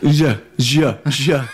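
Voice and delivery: slurred, stumbling "Je"; slurred words. Real, not acted. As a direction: trip repeatedly over "Je", slurring it. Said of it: "you're starting to slur your words".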